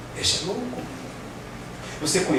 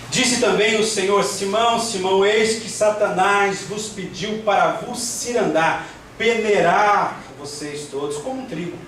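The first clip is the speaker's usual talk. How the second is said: raised, measured preacher's reading; scripture reading aloud; 5 semitones higher, slower than usual. Acted. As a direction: proclaim the verse phrase by phrase. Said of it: "vou ler rapidinho aqui". (leitura bíblica proclamada em tom elevado)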